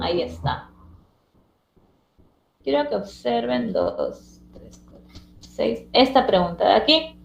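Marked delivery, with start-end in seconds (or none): none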